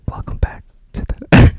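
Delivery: forceful whisper right into the mic; mock ASMR whisper. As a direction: whisper a show intro, too loud. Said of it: "A S M R laughing".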